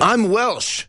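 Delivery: high-pitched mock Welshman; Jon Ronson. Co-host mocking the caller's claimed identity, high impression. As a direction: mimic him in a high put-on voice. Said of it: "Jon Ronson was like".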